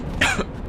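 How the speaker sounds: sudden choking cough; coughing up water. Real, not acted. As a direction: cough it out, something went down wrong. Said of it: "Coca-Cola went down the wrong pipe".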